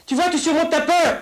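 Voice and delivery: sustained high-pitched yelling; shouting like papa. Acted. Playing papa to little Jean-Louis, shouting. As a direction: play a father yelling to cure a child's fear. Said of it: "je ne crie que pour te faire te montrer".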